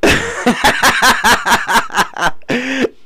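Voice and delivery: high, breathless giggling; high giggle. Real, not acted. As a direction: giggle helplessly. Laugh inside the retold scene.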